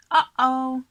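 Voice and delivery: loud rising uh-oh; Joe's worry. Acted. Joe sees the hungry dinosaur, loud uh-oh. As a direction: spot the danger. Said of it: "said Joe".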